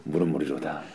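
deep, laughing solemn sage voice; Lee Bong-won impression. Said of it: "어, 진짜 비슷하다".

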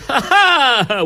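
slow laughing ha ha. Real, not acted. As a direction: laugh it out at the mistake. Laughing at wrong answer.

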